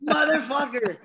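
tearful laugh at being called out; retold teary cursing with a laugh; laughing mock outburst. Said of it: "And I'm like".

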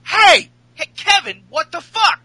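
mock outrage. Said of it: "he's like".

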